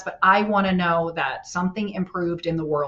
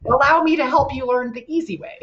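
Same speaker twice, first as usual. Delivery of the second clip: mock gracious; 6 semitones higher, 4 dB louder than usual. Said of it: "I was like".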